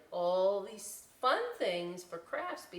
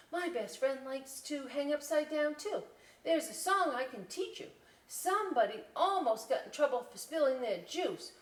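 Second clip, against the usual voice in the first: higher eager chatter; chattering Little Bat; 7 semitones higher, 4 dB louder than usual. Acted. Little Bat telling Mama Bat about school, eager higher voice. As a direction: tell mama everything at once.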